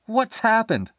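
high, startled question; crying out. Acted. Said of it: "she cried".

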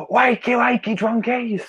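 high, gleeful wake-up yell; a gloating yell into the bedroom; screaming wake-up call. Retold or imitated. To hungover parents the next morning, high gleeful mocking yell. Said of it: "kicked in their bedroom door and screamed".

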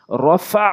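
"রফা" ending in a squeezed ain vowel; throaty ain. Shown as a demonstration. Teacher demonstrating ain in a word, throaty end on "রফা". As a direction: tighten the throat on the last syllable of "রফা". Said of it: "আইনের উচ্চারণ".

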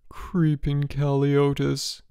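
slow, high feeble moan. A narrator's reading. George in bed believing he is dying, moaning. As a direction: moan it out slowly, drawn and sickly. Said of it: "George moaned".

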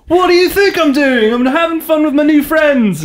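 high-pitched put-on British accent. Singer answering his bandmate, in a mock British accent. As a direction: retort in an exaggerated, high British voice. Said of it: "start talking in a British accent".

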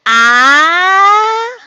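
first vowel of "a-" held long, rising; sounding out. Shown as a demonstration. Teacher sounding out a word for pupils, long held vowel. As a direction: stretch out the "a-" sound by itself.

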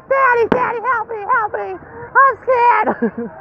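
high, frightened puppy pleading; scared dog voice. Retold or imitated. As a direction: voice the dog begging daddy for help. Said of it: "She comes by me and says".

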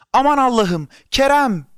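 high, loud scream of horror. A narrator's reading. Mother breaking through the crowd to Kerem, screaming. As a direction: scream out for your child. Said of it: "bir baş çığlık attı".

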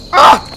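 short, loud shriek of fright; frightened scream. Real, not acted. Player caught mid-grab, frightened scream. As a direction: scream out in alarm. Jump scare in game.

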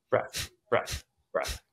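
quiet, unvoiced, popping exhales; sharp nose exhales. Shown as a demonstration. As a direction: blow each one out hard. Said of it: "Try to make them sharp".

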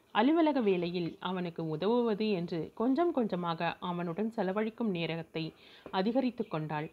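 ordinary delivery

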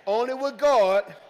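preacher leading the church in a loud refrain; loud call-out. Real, not acted. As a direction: belt it so they repeat it. Congregation told to repeat.

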